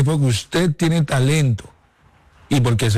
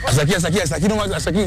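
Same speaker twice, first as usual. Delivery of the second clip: repeated higher-pitched field calls; coach calling drills; 4 semitones higher, much faster than usual. Real, not acted. Coach showing young players where to run, calling out. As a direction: direct the players to the mark.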